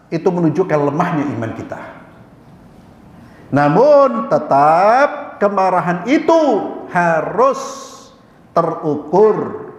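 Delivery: usual speaking voice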